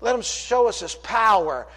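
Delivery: high, emphatic pulpit delivery; impassioned preaching. Acted. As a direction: build the scripture drama with force. Preaching at full force.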